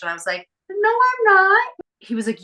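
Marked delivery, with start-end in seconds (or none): 0.7-1.7 high, loud, slow whiny denial; high whiny protest; indignant denial. Retold or imitated. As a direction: drag it out like a sulky teen. Denying dad's accusation.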